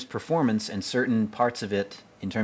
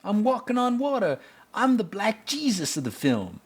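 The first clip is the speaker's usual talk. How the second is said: much higher, exaggerated mock accent; teasing the movie's character in a hammed-up voice; mock Chris Rock voice; 13 semitones higher than usual. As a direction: ham up a Chris Rock-style quip, pitched way up. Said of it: "He's playing like Chris Rock".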